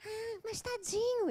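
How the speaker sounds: high, slow cooing; pitying friend. Retold or imitated. Friend pities boyfriend.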